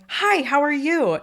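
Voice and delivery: high, slow, beaming hello; bubbly greeting. Retold or imitated. Jumping onto a sick girl's bed, bubbly high greeting. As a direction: greet her like an overjoyed kid.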